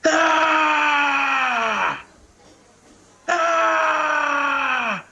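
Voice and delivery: hammed-up retelling of a frustrated actor screaming; imitated screams. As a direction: let out two drawn-out high mock screams of rage. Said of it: "he starts screaming".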